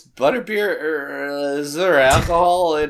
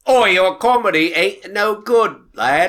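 put-on British accent; 6 semitones higher, 4 dB louder than usual. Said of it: "more British".

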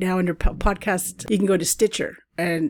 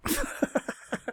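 whispered giggle; 7 dB quieter than usual. Real, not acted. Giggle after whispered swear.

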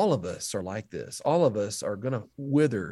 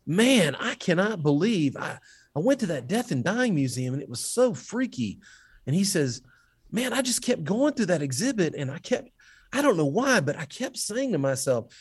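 high, excited retelling of a freaked-out man; spooked stranger; 9 semitones higher than usual. Retold or imitated. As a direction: voice a rattled tourist, pitched way up. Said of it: "this man turns and looks at me and says".

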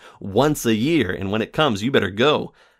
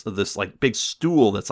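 ordinary talk, nothing marked

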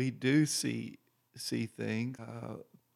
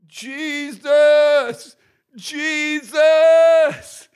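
long, high, loud repeated cries; shouting Jesus; 11 dB louder, much slower than usual. Retold or imitated. Healed woman shouting to Jesus in the meeting, drawn-out loud high cries. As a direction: cry out the name, louder each time. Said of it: "she said".

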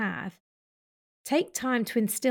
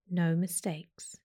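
guided meditation. Acted. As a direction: talk them through each breath gently.

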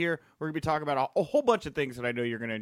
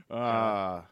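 ordinary talk, nothing marked

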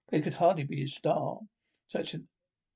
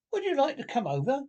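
high, lilting invitation; Rat's invitation; 9 semitones higher, faster than usual. Acted. Rat invites Mole across the river, high and bright. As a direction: call the offer up high and friendly.

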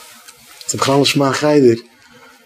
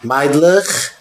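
higher, louder classroom command; שטרענגע לערערין; 6 semitones higher, 3 dB louder, slower than usual. Acted. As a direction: call the class to order, drawing out the count. אויסגעשפילטע לערערין קאמאנדירט א קלאס. (שטרענגער לערערין-טאן)

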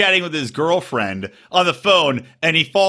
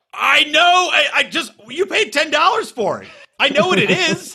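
high-pitched mock protest to a parent; exasperated retort; 11 semitones higher than usual. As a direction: re-enact an exasperated comeback, pitched way up. Retold exasperated argument with father.

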